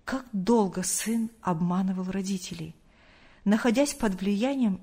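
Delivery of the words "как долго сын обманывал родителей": ordinary delivery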